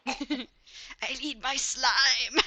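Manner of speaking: slow and laughing; frail breathless old person. Pretend. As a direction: croak it slowly through laughter. Mocking dying infected prisoner.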